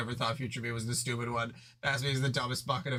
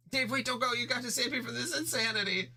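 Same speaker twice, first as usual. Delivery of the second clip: much higher, desperate plea; pleading Jade; 11 semitones higher than usual. Acted. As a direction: beg him not to leave. Jade begs Dave to stay.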